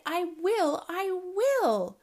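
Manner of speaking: crying out determinedly. Acted. Small owlet vows to fly, crying out. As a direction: insist as a small stubborn owlet. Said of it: "he cried".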